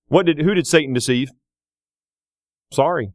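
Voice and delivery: normal talking voice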